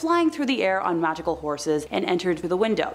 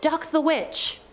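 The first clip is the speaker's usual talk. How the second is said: higher-pitched, restrained shout; mock crowd shout; 7 semitones higher, slower than usual. Narrator quotes crowd at the witch ducking, mild mock shout. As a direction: imitate a shouting crowd, without real volume. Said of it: "who began to shout".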